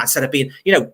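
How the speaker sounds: usual speaking voice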